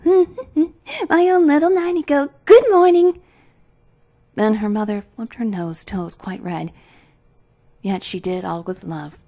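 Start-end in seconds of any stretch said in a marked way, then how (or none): 0.0-3.2 high, loud, drawn-out greeting; crying out. A narrator's reading. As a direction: sing out a teasing morning call.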